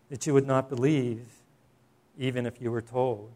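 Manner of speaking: measured divine pronouncement, long pauses between phrases; voice of the Lord. Acted. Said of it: "this is what the Lord says to him".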